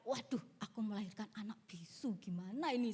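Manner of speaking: low, soft fretting; worried lament. Retold or imitated. New mother fearing her baby is mute, subdued lament. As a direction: fret quietly to yourself.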